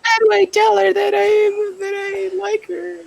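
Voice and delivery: put-on very high, slow, girly voice; squeaky lovestruck schoolgirl; high cute anime girl. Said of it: "High school problem?".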